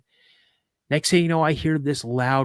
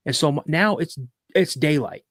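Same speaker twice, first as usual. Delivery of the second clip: plain reading voice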